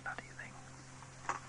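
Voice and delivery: whispered bloody thing under the breath; an exasperated whisper to himself; muttered curse. Real, not acted.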